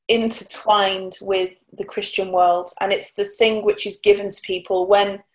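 ordinary delivery

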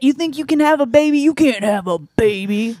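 high, loud aggressive taunt; mock aggressive. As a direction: challenge them loudly, hammed-up. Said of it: "Very aggressive".

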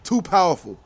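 emphatic put-on African accent; African-accented mock president. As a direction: issue a ban in a hammed-up African accent. Continues the mock president's decree.

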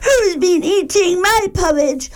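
little bear tells his mummy, high and upset; Baby Bear crying. Acted. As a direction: squeak it out like an upset cub. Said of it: "and cried".

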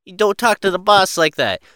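hammed-up gangster telling off the rookie; mobster scolding. Gangster scolding a rookie about the boss, loud and high. Playing along as a mafia member.

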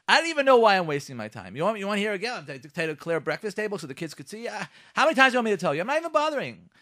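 high, loud exasperated spouse; acted nagging. One spouse to another about the breakfast table, acted exasperation. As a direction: nag in mock marital frustration. Acting out a quarrel between spouses.

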